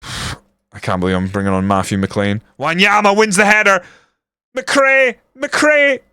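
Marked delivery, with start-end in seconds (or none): 2.6-6.0 high, mounting match excitement; urgent excited calling. Real, not acted. Gamer as Wanyama wins the header, high excited calling. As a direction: urge the striker on, voice shooting up. Urging player on during attack.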